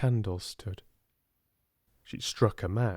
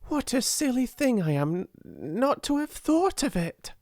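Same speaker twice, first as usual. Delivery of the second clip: excited cry; 14 semitones higher than usual. A narrator's reading. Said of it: "she cried".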